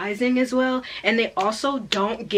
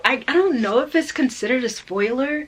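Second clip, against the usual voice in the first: normal talking voice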